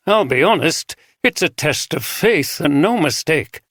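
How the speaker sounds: lightly voiced northern English bishop; northern accent. A narrator's reading. Bishop mourning the rector to the inspector, light northern English accent. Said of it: "pronounced traces of a northern accent".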